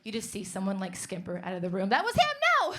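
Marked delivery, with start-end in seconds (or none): 1.9-2.7 mock call-out. Mock points out fleeing boyfriend.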